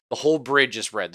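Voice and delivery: ordinary talk, nothing marked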